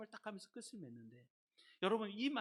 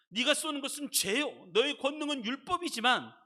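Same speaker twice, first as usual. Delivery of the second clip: high, loud defiant challenge to death; crying out scripture; 8 semitones higher, 9 dB louder than usual. Real, not acted. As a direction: proclaim it at full voice. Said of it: "큰 소리로 외치면서 읽었어요". (사망을 향해 높고 크게 외치기)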